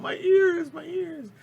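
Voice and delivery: high, loud hammed-up cry of pain; hammed-up high wail of sore ears; mock pained friend. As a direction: squeal like your ears are ringing. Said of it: "she's sitting there going".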